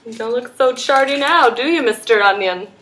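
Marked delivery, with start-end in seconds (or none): none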